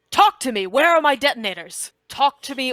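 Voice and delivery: commanding, loud ultimatum; forceful demand. Acted. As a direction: bark it into the radio as a threat. Kronz threatens over sending stone.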